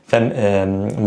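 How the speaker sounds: normal talking voice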